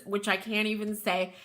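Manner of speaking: ordinary talk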